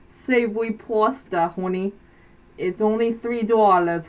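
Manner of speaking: put-on sweet-talking Boston accent, hon and honey. Boston waitress accent selling pasta to a customer. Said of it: "it is the Boston accent".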